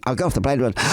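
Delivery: ordinary talk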